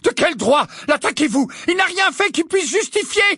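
high, indignant challenge; McGonagall. A narrator's reading. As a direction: demand answers in outrage. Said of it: "s'exclama la voix du professeur McGonagall".

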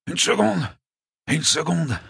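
high, strained, suddenly insistent; dying interjection. A narrator's reading. Dying Mike remembering something, strained and insistent. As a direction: interrupt as if something important was forgotten. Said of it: "d'une voix un peu plus forte".

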